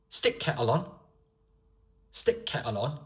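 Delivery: regional British accent with "the" dropped. Shown as a demonstration. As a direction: put on a regional accent, rising on "on". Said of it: "I also put an accent there".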